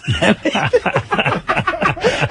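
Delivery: squealing laugh way up high; real giddy laugh; high burst of laughter. Real, not acted. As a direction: crack up helplessly, high pitched. Laughing at own heckle story.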